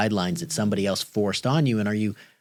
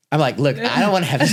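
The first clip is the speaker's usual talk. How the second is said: laughing, higher and louder, rushing; 6 semitones higher, 7 dB louder, faster than usual. Retold or imitated. Dad retelling the awkward talk with his daughter, laughing. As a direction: hurry through it half-laughing.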